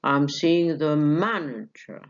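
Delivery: slow, stress on seeing, fall at the end; falling on manager. Shown as a demonstration. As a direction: drop down through manager. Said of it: "هنزل بقى". (نغمة هابطة على manager)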